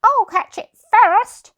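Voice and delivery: high, loud eager dog; third dog. Acted. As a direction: shout to be first in the chase.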